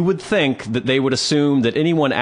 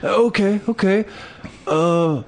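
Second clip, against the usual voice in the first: higher, hesitant sheepish okays; sheepish drunk guy; 8 semitones higher, slower than usual. Retold or imitated. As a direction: give in nervously, stalling. Imitating guy giving in.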